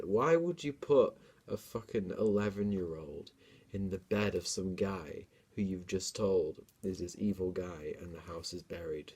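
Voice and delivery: usual speaking voice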